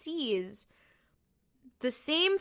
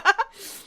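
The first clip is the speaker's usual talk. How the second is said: short laugh; 10 semitones higher, 6 dB louder than usual. Real, not acted. Laughing with co-host.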